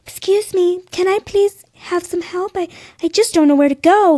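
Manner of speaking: high, pleading put-on voice; helpless baby deer. Demonstrating asking strangers for help, put-on helpless pleading. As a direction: fake sweet, desperate innocence. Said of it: "like a baby deer who's in need of desperate help".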